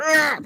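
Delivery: sharp high yelp, imitating own outburst; a short high cry of annoyance; mock yelp of anger. Losing temper at a falling lamp stand, sharp high yelp. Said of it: "I went".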